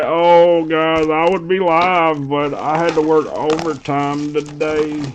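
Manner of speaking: slow, drawn-out whiny impression; mock whining. Imitating Irish complaining to everybody about overtime, whiny. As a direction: stretch every word into a mocking moan. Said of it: "y'all should hear all the whining".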